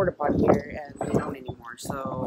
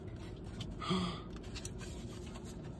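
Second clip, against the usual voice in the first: sharp breathless gasp of awe; soft gasp; 2 semitones higher, 14 dB quieter than usual. Real, not acted. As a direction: catch your breath at the gift. Opening the gift.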